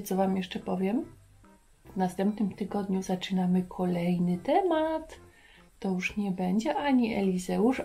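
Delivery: usual speaking voice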